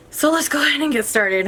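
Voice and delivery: breathless, catching breath. Real, not acted. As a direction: speak while winded. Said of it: "I'm already out of breath".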